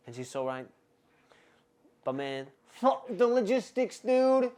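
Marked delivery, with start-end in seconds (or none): 2.7-4.5 slow, loud and high; defiant shout. Real, not acted. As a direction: blow off the caution loudly. Defiant outburst.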